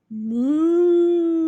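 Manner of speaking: long, loud moo; cow moo. Acted. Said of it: "It's part of me".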